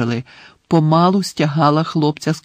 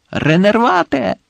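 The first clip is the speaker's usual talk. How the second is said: high, urgent exclamation; cried-out spell; 7 semitones higher than usual. A narrator's reading. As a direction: cast the spell with a desperate cry. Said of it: "вигукнув він".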